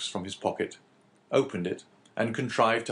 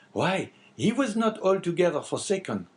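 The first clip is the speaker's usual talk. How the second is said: higher-pitched innkeeper character voice; lightly voiced Caderousse; 10 semitones higher, 4 dB louder than usual. Innkeeper answering the abbé, lightly voiced character. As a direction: lightly play the talkative innkeeper.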